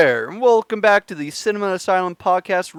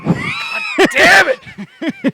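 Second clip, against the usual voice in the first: drawn-out, high exasperated cry; exasperated outburst; much slower than usual. Real, not acted. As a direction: let out a long, frustrated curse. Mock exasperation at co-host's jokes.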